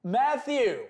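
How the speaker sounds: high, drawn-out hype announcer call; big sing-out thank-you to a donor; streamer hype shout-out. Real, not acted. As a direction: stretch the name out high and slide down. Streamer hyping a sub gift.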